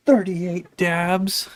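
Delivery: loud announcement. Real, not acted. Finishing count loudly.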